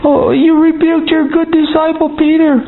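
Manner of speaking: high, sneering church-lady voice; mock high voice of a disapproving believer; mocking critic.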